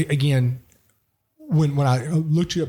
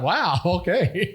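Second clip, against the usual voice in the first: higher, laughing retold reaction; laughing amazement; 6 semitones higher, much slower than usual. Host retells his surprise at the Frenchman's praise, laughing amazement. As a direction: act out pleased surprise through a laugh.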